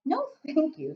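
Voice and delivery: put-on little girl voice. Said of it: "Sophie said".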